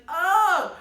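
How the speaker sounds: high, loud "ah" yell; sudden high shout for the final shot; mock battle yell. Recapping her final shot, loud mock battle yell. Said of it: "She's like".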